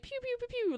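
high playful pew pew sounds; pew pew gun noises. Retold or imitated. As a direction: make kid-style gunshot noises. Imitating finger gun sounds.

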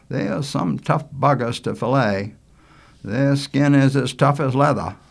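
a hint of a folksy fisherman; agreeing older cousin. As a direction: answer a young cousin in hearty agreement. Manley answers his cousin.

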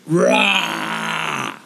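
long raspy "rah" roar; monster-like entrance roar; drawn-out roar. Acted. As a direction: roar your way in. Character barges onto the mic.

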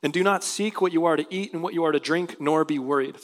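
normal talking voice

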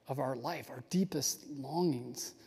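breathy whisper, slowing down. Real, not acted. Teacher whispering to the viewers about deepest longings.

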